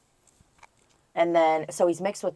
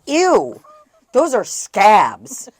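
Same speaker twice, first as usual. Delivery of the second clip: high, loud disgusted "Ew", then drawn out slow; loud grossed-out reaction, dragged slowly; 5 semitones higher, 11 dB louder, much slower than usual. Retold or imitated. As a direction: recoil in mock disgust. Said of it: "I was like".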